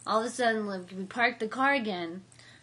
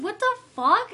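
high, loud, baffled exclamation; imitating her own startled reaction at the party; shocked outburst; 10 semitones higher, 8 dB louder than usual. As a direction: throw it out in disbelief. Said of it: "And I'm like".

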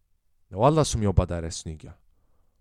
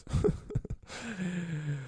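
real laugh; 9 semitones higher, 8 dB quieter than usual. Real, not acted. Laughs at own story.

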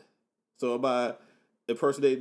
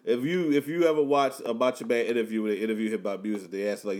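normal talking voice

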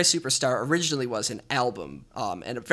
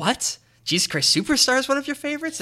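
high-pitched, louder mock disbelief; incredulous parents; 12 semitones higher, 4 dB louder than usual. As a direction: imitate astonished parents questioning a favorite. Parents' surprised reaction, retold.

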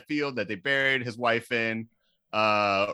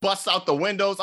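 usual speaking voice

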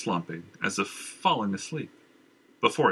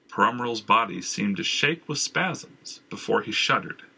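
ordinary delivery, nothing marked

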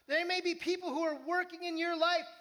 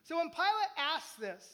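ordinary delivery

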